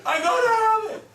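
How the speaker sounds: high, loud excited shout; excited outburst. Retold or imitated. Exclaims in a retold craving.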